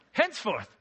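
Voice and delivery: high, loud mock British accent; bad British impression. Preacher hamming up a Bible word, bad British accent. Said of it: "That's a bad impression".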